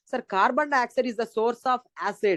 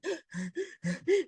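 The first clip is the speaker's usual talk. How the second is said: sharp high gasping in-breath; mock sob gasp; hyperventilating gasp; 9 semitones higher, 8 dB quieter than usual. Shown as a demonstration. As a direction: gasp like a girl crying hard. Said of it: "doing hyperventilation".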